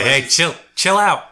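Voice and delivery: high-pitched acted sentry; guard calling down. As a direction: tell the stranger to back off. Guard calms approaching party.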